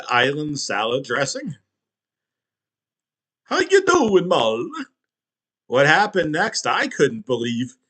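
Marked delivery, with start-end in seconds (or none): 3.4-4.7 high, loud Italian guy greeting; breezy cartoon Italian accent. A narrator's reading. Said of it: "typical Italian stereotype".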